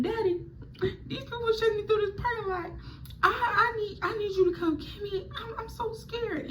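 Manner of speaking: put-on frightened teen crying to her dad on the phone; scared crying plea. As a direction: beg your father through tears.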